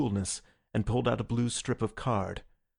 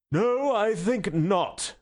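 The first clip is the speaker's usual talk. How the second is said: high, slow yawned refusal; yawning; 10 semitones higher, 4 dB louder, much slower than usual. A narrator's reading. President brushes off the secretary, yawning. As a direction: speak right through a big yawn. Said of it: "said the president with a yawn".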